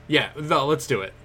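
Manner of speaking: normal talking voice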